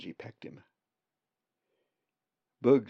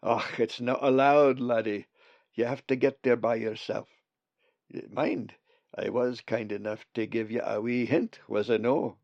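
put-on louder Scottish burr, old raven; broad friendly Scots voice; scottish accent; 5 dB louder than usual. Bugs the raven speaks.

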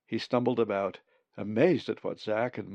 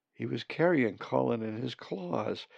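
ordinary delivery

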